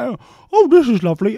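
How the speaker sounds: high, lightly gushing delight; hammed-up delight; mock delight. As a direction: gush over the biscuit. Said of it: "So I'm like".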